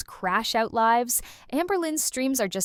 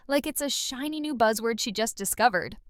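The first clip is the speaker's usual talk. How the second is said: usual speaking voice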